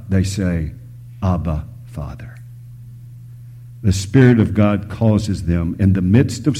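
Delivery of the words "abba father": soft, low, near-whispered appeal; hushed prayer. Acted. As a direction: breathe it out as a reverent prayer. Quotes a hushed, reverent prayer.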